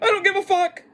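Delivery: high, loud defiant holler; defiant outburst. Acted. Host expects to get clipped, sudden loud defiant outburst.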